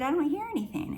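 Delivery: insistent repeat. Retold or imitated. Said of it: "I kept telling her that I don't hear anything".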